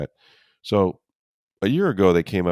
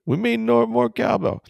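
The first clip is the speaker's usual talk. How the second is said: excited catchphrase. Pretend. Jokey cowbell catchphrase.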